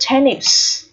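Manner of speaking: "tennis" with a clear short E first; E sound. Shown as a demonstration. Teacher shows learners the E vowel, slowly modelled. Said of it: "vẫn được phát âm là E".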